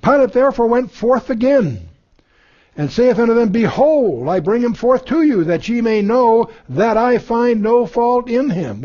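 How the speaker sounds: scripture reading. Acted. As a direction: proclaim the verses from the pulpit. Reads scripture passage aloud.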